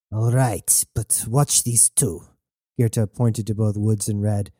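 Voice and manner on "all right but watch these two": growling. A narrator's reading. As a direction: give the order in a restrained growl. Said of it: "Goethe looked to Wyatt and growled".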